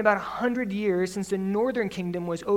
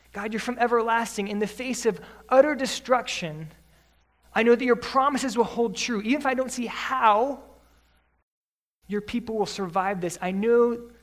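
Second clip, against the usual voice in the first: ordinary talk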